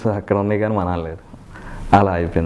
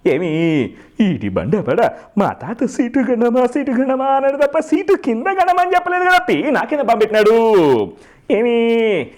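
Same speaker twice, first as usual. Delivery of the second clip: Jayaprakash Reddy, comic version; 8 semitones higher than usual. Acted. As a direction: stretch the words and climb into a high, whining rant. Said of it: "comedy చేసేటప్పుడు వాయిస్లో sharp వస్తూ ఉంటుంది". (Sharp గొంతుతో సాగదీస్తూ comedy villain యాస)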